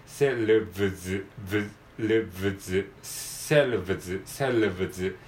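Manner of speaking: selves ending in a run of L, V and buzzed Z. Shown as a demonstration. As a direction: drill selves with each of L, V, Z sounded. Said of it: "エルブイゼットっていう感じで".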